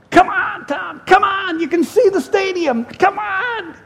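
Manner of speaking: high, shouted coaxing of a running buddy; partner urging on. Retold or imitated. Running partner pushing the preacher to finish the race. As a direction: cheer him on toward the finish.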